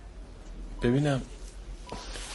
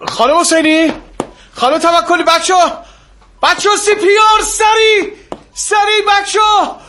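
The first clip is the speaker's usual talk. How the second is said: urgent shout; 6 semitones higher, 9 dB louder than usual. Acted. Doctor shouting to the nurses for CPR, urgent yell. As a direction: shout for the team, higher and louder.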